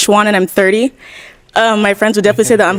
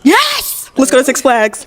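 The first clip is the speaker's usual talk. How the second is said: high-pitched excited squeal of a yes; real thrilled, high-pitched exclamation; excited outburst; 6 semitones higher, slower than usual. Real, not acted. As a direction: burst out with sudden eager excitement. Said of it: "Sorry, I got excited".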